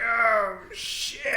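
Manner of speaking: high drawn-out gasp of alarm, trailing to breath; mock shock. Retelling seeing a mountain lion stalk someone, mock shock. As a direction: imitate a stunned, high-pitched reaction.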